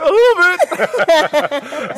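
mock-crying answer to his wife; teary admission. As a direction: drag it out as if sobbing. Admits crying on phone.

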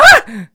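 sudden shrill mock scream, very high and loud; imitated scream. Retelling the night scream to a friend, sudden loud mock scream. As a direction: recreate the scream heard in the night. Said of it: "Like that sort of thing".

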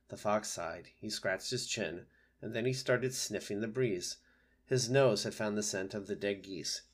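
usual speaking voice

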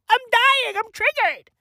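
normal talking voice

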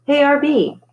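high, loud hail by name; calling for attention. A narrator's reading. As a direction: get his attention before bad news. Character breaks unwelcome news.

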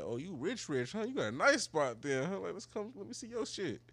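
high, playful mock-impressed quote; teasing flattery. Retold teasing flattery of date.

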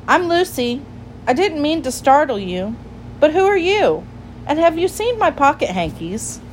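put-on high, polite child's voice; storybook girl, apologetic then asking; friendly little girl. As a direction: introduce yourself high and gently curious. Voices Lucy introducing herself.

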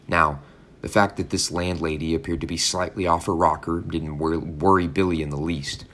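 ordinary delivery, nothing marked

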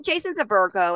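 normal talking voice

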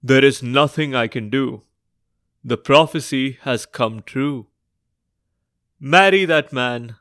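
lightly voiced solemn king; a hint of a resigned old king. King's parting words to princess.

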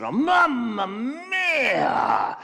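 put-on loud, high, exasperated Italian accent; big operatic Italian outburst; hammed-up Italian worker. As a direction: wail it like an over-the-top Italian, fed up. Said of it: "the Italian opened his lunchbox".

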